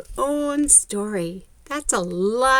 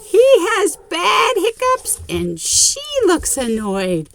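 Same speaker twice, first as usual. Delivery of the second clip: excited; 10 semitones higher, 6 dB louder than usual. Acted. Excited picture-book reading.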